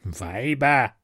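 a lightly voiced grumbling goblin; muttering goblin. A narrator's reading. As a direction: mutter it with contempt. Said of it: "murrte er".

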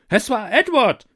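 high, loud accusation; accusing cry. Acted. The court blames Edward, loud and accusing. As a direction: everyone points the finger at once. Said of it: "sagten sie alle".

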